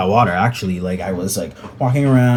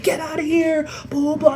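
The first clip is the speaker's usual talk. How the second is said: chasers yelling. Retold or imitated. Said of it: "They're like".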